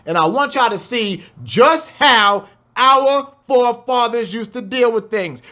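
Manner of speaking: ordinary delivery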